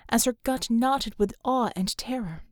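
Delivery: ordinary delivery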